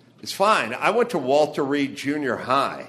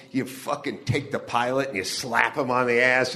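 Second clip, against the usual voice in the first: usual speaking voice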